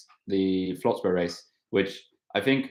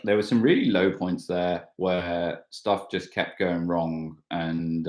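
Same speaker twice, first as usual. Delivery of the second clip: ordinary delivery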